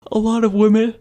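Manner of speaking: words stretched through a yawn. Real, not acted. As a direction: speak mid-yawn, mouth wide open. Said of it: "Sorry about that yawn".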